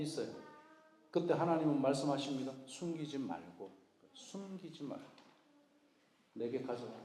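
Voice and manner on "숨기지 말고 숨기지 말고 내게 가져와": soft, slow, hushed plea as God; tender invitation. Pretend. Pastor voicing God inviting the hurting to come, hushed and tender. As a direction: coax gently, almost a whisper.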